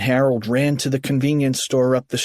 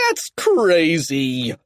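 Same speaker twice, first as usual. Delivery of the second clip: slow, higher scoffing holler; yell; 4 semitones higher, much slower than usual. A narrator's reading. Kipper dismissing cheerleaders' ghost, drawn-out yell. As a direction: stretch it out in disbelief. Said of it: "Kipper yelled".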